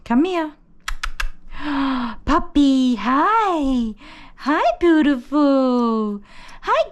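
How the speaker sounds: high-pitched, drawn-out cooing to a puppy; puppy talk. Real, not acted. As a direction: coax the puppy over in a high, doting voice. Calling and greeting the puppy.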